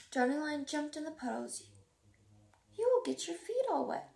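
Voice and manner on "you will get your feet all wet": higher, louder warning call. A narrator's reading. Mother calling to her son in the puddles, warning. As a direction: call across to him. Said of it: "called Mother Lion".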